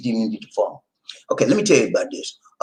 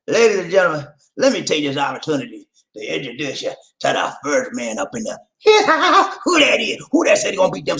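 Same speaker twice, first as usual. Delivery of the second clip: excited emcee intro; 6 semitones higher than usual. Acted. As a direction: sell it like a ring announcer. Host introduces caller, laughing.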